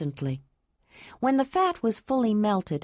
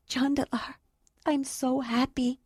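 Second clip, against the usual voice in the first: happy tears breaking through the words; voice cracking; 6 semitones higher than usual. Acted. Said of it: "her voice cracking as tears filled her eyes".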